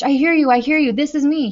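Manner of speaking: high, loud, eager answer; urgent reply. A narrator's reading. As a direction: shout back into the mic so they hear you. Boy answers voice at last.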